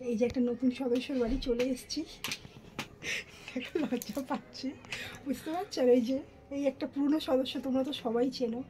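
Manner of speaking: very soft, nearly whispered in places; hushed talk. Real, not acted. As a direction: keep your voice down near a sleeper. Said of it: "এখানে একটু আস্তে আস্তে কথা বলছি". (চাপা গলায় আস্তে কথা)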